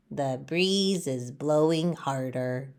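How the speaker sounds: a worried leaf thinking aloud, low and soft; small worried leaf. Acted. Leaf talks to himself, low and soft. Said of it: "Leaf says to himself".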